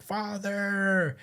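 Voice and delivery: high-pitched, drawn-out call; imitated cry for father. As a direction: call out like a child in a flashback. Voices a voice heard in flashback.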